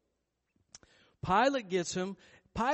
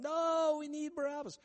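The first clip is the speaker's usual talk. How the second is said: crowd's high cry; 9 semitones higher than usual. Retold or imitated. Crowd demands Barabbas.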